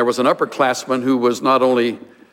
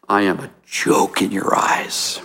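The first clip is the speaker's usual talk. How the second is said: slow, hushed whisper; 6 semitones lower than usual. Acted. Job to his friends, slow hurt whisper. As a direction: let it fall to a wounded whisper. Job's bitter lament voiced.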